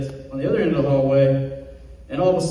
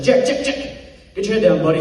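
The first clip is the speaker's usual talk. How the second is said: calling the dog; 3 semitones higher, 3 dB louder, faster than usual. Retold or imitated. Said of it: "I said".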